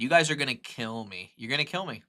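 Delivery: normal talking voice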